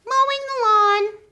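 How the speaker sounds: very high, loud puppet voice; squeaky puppet. Pretend. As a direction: play the eager little puppet pal. Puppet Whiskers speaks.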